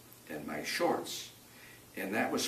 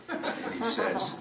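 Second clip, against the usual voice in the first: laughing; 14 semitones higher, 4 dB louder, slower than usual. Real, not acted. Laughing while retelling rescue.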